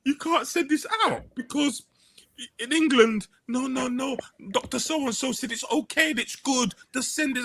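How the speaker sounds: laughing through a high, squeaky imitation; laughing mimicry.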